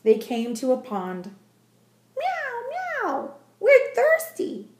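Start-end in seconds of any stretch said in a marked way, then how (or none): 2.2-4.7 very high, slow meowing cats; high cat voices. A narrator's reading. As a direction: squeak it like a crowd of kittens. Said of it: "cried the hundreds of cats".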